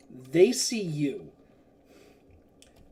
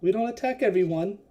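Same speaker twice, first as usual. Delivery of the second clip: lightly Scottish holy man, pitched up; mild William Wallace; 5 semitones higher than usual. Acted. Holy man restraining his mercenaries, mild Scottish voice. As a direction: call the halt in a faint Scots accent. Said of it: "very mild William Wallace".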